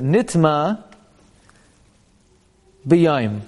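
"Nitma" rising and held open, long pause, "B'Yayim" falling; phrased reading. Shown as a demonstration. Teacher asks how you read this, then demonstrates with split phrasing. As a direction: lift "Nitma", wait, then drop on "B'Yayim". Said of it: "how do you read this?".

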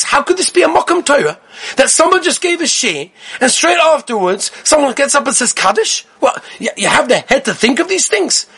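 high, indignant disbelief; imitating a rabbi's shocked protest; retold upset outrage. Rabbi scolding the yeshiva over Kaddish, high indignant. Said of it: "He was so upset".

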